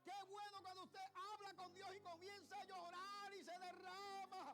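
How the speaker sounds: high, loud cry drawn out at phrase ends; impassioned preacher crying out to the congregation; full-force preaching. Real, not acted. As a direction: build to a slow, shouted pulpit climax.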